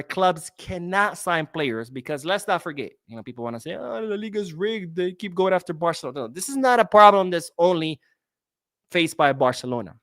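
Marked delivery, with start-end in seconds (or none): 3.7-6.2 higher-pitched voice of grumbling fans; mimicked complaining fans. Host voicing fans who say the league is rigged, mimicked complaint. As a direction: put on a complainer's voice, high.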